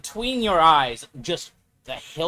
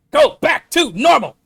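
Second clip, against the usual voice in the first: shout; 11 semitones higher, 12 dB louder than usual. Pretend. Shouting at the radio.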